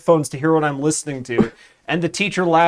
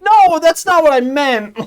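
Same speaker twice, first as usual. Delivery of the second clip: high, louder exasperated backpedal; flustered correction; 13 semitones higher, 6 dB louder than usual. Retold or imitated. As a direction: insist you were misunderstood.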